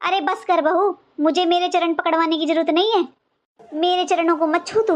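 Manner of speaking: usual speaking voice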